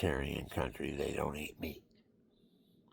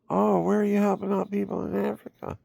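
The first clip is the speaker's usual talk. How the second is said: priest's question; 14 semitones higher, 5 dB louder than usual. Retold or imitated. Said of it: "And he goes".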